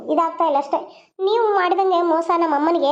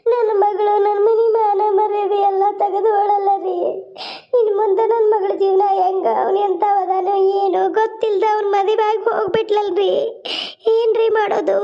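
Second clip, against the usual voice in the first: tearful lament; 3 semitones higher than usual. Acted. Mother wailing to her husband, tearful and pleading. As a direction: wail over the daughter's shame. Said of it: "ಅಳಬೇಡ, ಸಮಾಧಾನ ಮಾಡ್ಕೊ".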